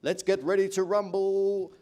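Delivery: drawn-out ring announcer call; Michael Buffer. Acted. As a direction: announce the big fight, stretching it out. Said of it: "And Michael Buffer".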